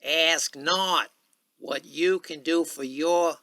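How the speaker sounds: high, loud oratorical Boston cadence; booming presidential speech voice; Kennedy impression. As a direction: declaim like a president at a podium. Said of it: "I'll do one of his other speeches first".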